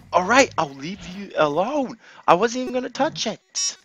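loud, high whine of protest; squealed backing off; high-pitched protest. Real, not acted. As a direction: plead innocence in a high voice. Protesting to game enemy.

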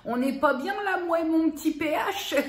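words broken by real laughter; laughing. Real, not acted. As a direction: giggle through the line, pleased with yourself. Amused at her lip colour. (en riant, ravie de sa petite couleur)

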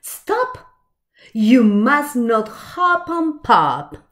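usual speaking voice

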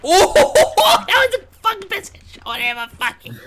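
very high, laughing whoop of triumph; ecstatic. Real, not acted. Player celebrating the best shot ever, ecstatic squealing. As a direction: squeal with delight through laughter.

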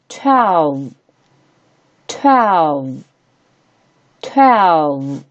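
twelve drawn out, falling, with pauses; slow model reading. Shown as a demonstration. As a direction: say twelve slowly for learners to copy. Teacher models number for repetition.

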